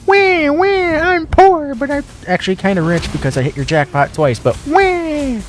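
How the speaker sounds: put-on high wailing baby whine; crying baby whine. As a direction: whine like a bawling toddler. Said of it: "flail my arms and my legs like a little baby".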